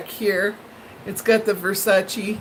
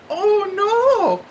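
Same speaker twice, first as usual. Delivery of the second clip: high, dismayed "Oh no"; shocked oh no; 10 semitones higher, 3 dB louder than usual. Retold or imitated. Recalling the frame price, high shocked oh no. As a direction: gasp it out in disbelief. Seeing three hundred dollars.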